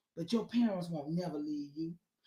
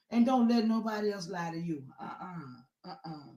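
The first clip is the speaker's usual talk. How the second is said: usual speaking voice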